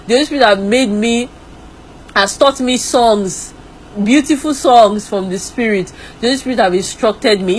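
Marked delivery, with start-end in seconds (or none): none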